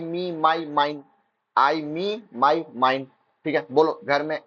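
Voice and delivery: normal talking voice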